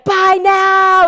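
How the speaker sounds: high, loud sung-out sales pitch; mock shopping channel host singing buy now; infomercial hype. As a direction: belt it like a cheesy TV seller. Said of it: "and they're like".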